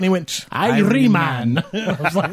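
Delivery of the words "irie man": slow, drawn-out mock Jamaican; hammed-up Jamaican accent; bad Jamaican accent. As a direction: imitate a friend's awful attempt at Jamaican. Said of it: "trying to do Jamaican".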